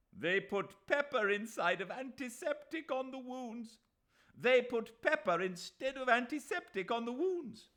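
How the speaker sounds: high, loud accusation; outraged shout. A narrator's reading. Mahmud Ali inflaming the crowd, outraged shout. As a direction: stir the crowd's fury.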